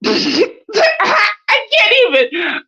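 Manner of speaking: high, slow, cracking laugh-cry; laughing shout. Real, not acted. Hearing the baby mother is Irish, howling with laughter. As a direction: howl with laughter through the words.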